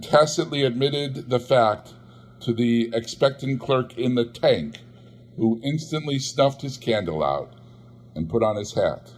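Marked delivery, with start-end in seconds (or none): none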